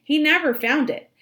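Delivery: usual speaking voice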